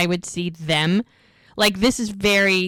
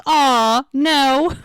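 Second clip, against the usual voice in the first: higher, louder drawn-out letdown; mock apology; 7 semitones higher, 5 dB louder than usual. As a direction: playfully break the bad news. Brother lets sister down, no lunch.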